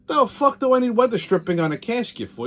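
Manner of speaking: indignant outburst. Retold or imitated. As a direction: scoff at the idea of weather stripping. Mocking the salesman's upsell.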